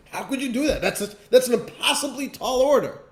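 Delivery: high, laughing protest; incredulous laugh. Retold or imitated. Student laughing in disbelief at the Torah's command, high. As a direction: laugh off the demand as absurd. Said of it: "you say".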